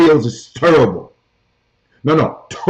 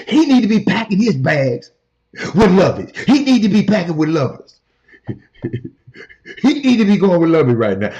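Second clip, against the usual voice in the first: ordinary talk, nothing marked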